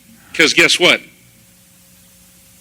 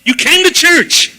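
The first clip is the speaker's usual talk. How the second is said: high, bright-eyed greeting, slowed; delighted greeting; 10 semitones higher, much slower than usual. Retold or imitated. Friend Rachel spotting him after the service, delighted high greeting. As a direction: beam at him as if he finally came. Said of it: "with this huge smile".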